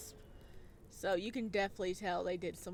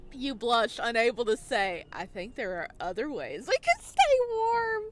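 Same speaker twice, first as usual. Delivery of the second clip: flustered laughing read; 10 dB louder than usual. Real, not acted. Reading flirt choice flustered.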